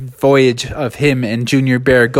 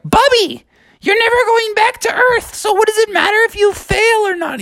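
very high little bear voice; high cartoon cub, matter-of-fact; squeaky Junior Bear. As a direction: reason with him in a tiny squeak.

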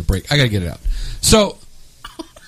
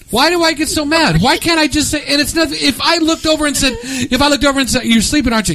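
pitched up, animated ranting; high exasperation; 12 semitones higher than usual. Real, not acted. Host baffled at his own grumpiness, pitched high. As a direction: throw your hands up at yourself.

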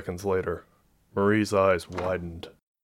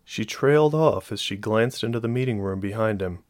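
plain reading voice

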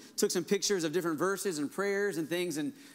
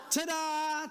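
high, bright showman flourish; showman's ta-da; 10 semitones higher than usual. Shown as a demonstration. Magician revealing a rabbit from a hat, grand high ta-da. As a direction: present it like a magic trick. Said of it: "what do they say".

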